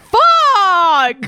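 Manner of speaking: high, loud yell of dismay; loud outburst. Pretend. Host reacts to being teased about letter, high loud yell. Outed as the question's sender.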